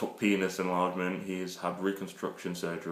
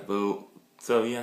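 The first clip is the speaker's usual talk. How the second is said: ordinary talk, nothing marked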